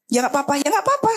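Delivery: high, hurried mock receptionist; flustered receptionist. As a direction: imitate a flustered clerk begging. Meniru resepsionis yang panik. (meniru resepsionis yang gugup, nada tinggi)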